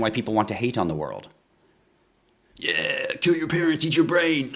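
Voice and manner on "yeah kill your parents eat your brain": higher, hammed-up villain taunt; mock evil voice. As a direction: play a cartoonish cheering maniac, lightly. Mocking a hateful gun owner.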